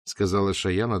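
ordinary delivery, nothing marked

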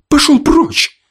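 very high shrieking command; screaming. A narrator's reading. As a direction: shriek him away in fury. Woman shoves the corpse back.

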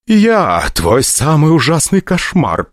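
menacing goddess. Acted. Goddess threatens the rich kid.

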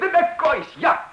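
very high, loud delighted exclamation with a laugh; light up at the memory; delighted cry. Acted. Customer thrilled by the shopkeeper's words, laughing.

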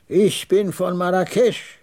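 high, slow, proud statement; slow, high-pitched pride; proud old trader. A narrator's reading. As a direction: declare it proudly in the old man's voice. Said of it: "sagte er stolz".

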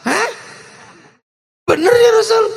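shocked disbelief. Retold or imitated. Umar asking the Prophet, shocked high disbelief. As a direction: gasp it out in disbelief.

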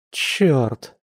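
understated heated curse; a touch of exasperation; vexed muttering. A narrator's reading. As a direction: curse to yourself in vexation. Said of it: "в сердцах пробормотала лиса".

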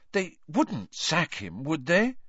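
high, slow and anxious. A narrator's reading. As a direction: fret over it slowly. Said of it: "said Hermione anxiously".

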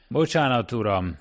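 plain reading voice

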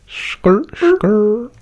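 high rising skrr sounds; skrr ad-lib. Pretend. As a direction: squeal it high like tires. Imitating tire screech ad-lib.

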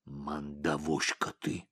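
low, slow, quiet muttered insult; grumbling. A narrator's reading. Bodyguard insults his boss as he leaves, muttering low. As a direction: grumble it sourly under your breath. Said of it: "проворчал телохранитель".

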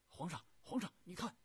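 loud, high-pitched alarmed call; sudden excited shout to a superior; urgent calling out. Acted. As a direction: cry out to get the emperor's attention at once. Officer urgently alerts his emperor.

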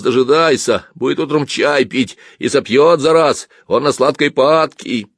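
high, loud, aggrieved grumbling; angry complaint. Acted. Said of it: "рассерженно ответил тот". (рассерженная жалоба)

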